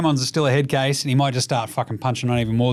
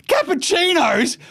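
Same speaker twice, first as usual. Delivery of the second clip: much higher, drawn-out disbelief on Cappuccinos; incredulous; slower than usual. Real, not acted. Host repeating Jimmy's coffee order in disbelief, high and slow. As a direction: repeat Cappuccinos slowly and high, as if it's absurd.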